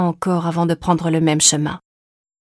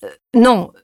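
higher, louder flustered denial; husband caught out, blurting a louder, higher denial; flustered exclamation; 5 semitones higher, 6 dB louder than usual. A narrator's reading. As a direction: blurt a panicked no. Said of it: "s'exclama Sylvain".

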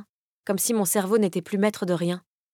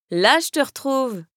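higher, slow, laughing exclamation; exclaiming with a laugh; 6 semitones higher, 4 dB louder, slower than usual. Acted. The heroine teasing Kaz back, laughing and higher. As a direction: toss it out through a laugh. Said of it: "m'exclamai-je dans un rire".

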